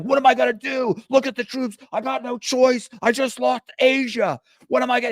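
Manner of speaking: high squeaky excuse-making, whiny and worked up; mock defensive rant. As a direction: whine that there was no choice. Double-crossing player defends himself.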